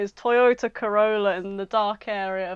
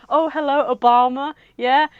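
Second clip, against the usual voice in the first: loud, higher fake phone greeting; pretend phone call; 5 semitones higher, 8 dB louder than usual. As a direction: fake answering an important call. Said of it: "He pretends to pick it up and he goes".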